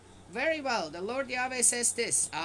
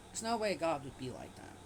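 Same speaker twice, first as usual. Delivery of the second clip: ordinary talk, nothing marked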